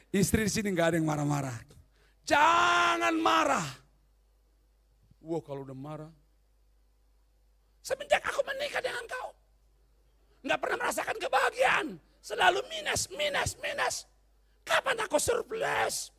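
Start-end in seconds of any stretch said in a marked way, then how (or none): 2.2-3.7 slow, higher, louder mock shout; mock angry elder. Preacher mocks an angry elder. 7.8-16.1 much higher shrieking wife voice; a wife screeching her complaints; nagging wife. Retold or imitated.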